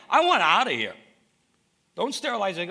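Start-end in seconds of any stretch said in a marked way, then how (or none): 0.1-1.0 sharp, much louder cry to be let out; desperate plea. Acted. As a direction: shout it like a man trapped. Preacher voicing Joseph's plea.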